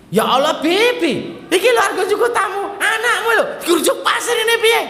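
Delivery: high shouting complaint; panicked maid. Retold or imitated. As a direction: shriek at the mother about the sand. Maid complaining loudly to mother.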